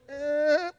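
high, soft mock infant fuss; small squeaky whine; little baby noise. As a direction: imitate a baby starting to fuss. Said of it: "make a little noise".

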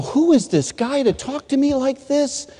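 high-pitched retold indignation; indignant. Student angry at the man's words, indignant. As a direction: protest in a high, offended voice. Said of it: "Then I got mad".

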